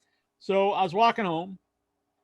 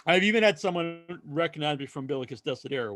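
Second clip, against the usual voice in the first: ordinary talk, nothing marked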